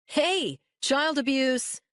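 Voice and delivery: high, slow mock outrage; mock protest. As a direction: cry foul in jest. Tommy mock protest after punch.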